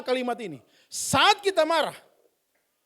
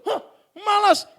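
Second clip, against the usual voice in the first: lazy refusal; 8 semitones higher, slower than usual. Acted. Child lazily refusing.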